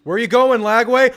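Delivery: loud, high taunt; taunting shout. Real, not acted. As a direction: jeer at him from the couch. Taunting the opposing quarterback.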